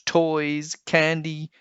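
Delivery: plain reading voice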